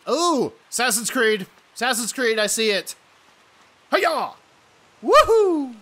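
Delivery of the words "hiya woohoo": high, loud whoop; excited whoop. Real, not acted. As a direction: cheer mid-leap. Jumping like Assassin's Creed.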